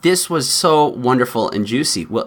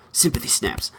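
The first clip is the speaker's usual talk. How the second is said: a breathless mock whisper, much softer; whispered aside; 3 semitones higher, 7 dB quieter than usual. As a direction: hush the phrase like a secret joke. Mock whisper after the parody.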